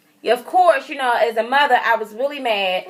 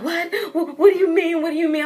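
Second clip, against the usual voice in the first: higher-pitched flustered confusion; panicky; 6 semitones higher, faster than usual. Retold or imitated. Sister asked for a lollipop, imitated panicky confusion. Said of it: "she's all panicky".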